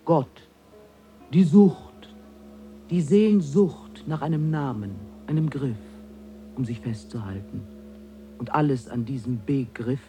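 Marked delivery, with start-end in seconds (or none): 1.3-5.8 loud, high exclamation; vehement scorn. Acted. A higher being decrying the human craving for names, vehemently. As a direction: throw it out loudly, scornfully.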